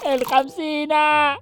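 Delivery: slow, high drawn-out show name; announcer call. Acted. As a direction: sing out the show name like a jingle.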